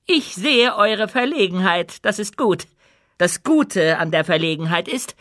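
high public-speech voice, settling at the close; commanding queen. A narrator's reading. Queen addresses her embarrassed subjects, commanding. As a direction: lecture the crowd from the throne.

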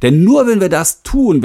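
ordinary delivery

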